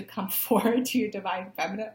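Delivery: laughing through the words; breaking into giggles mid-sentence; real amused laughter spilling over. Real, not acted. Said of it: "I'm laughing".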